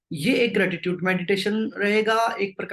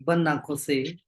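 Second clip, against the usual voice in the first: low, unhurried meditation-guide voice with long pauses; spaced-out, lowered, calming instructions; guided meditation; 3 semitones lower than usual. Real, not acted. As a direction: settle the group gently, leaving long silences. Leads group into meditation.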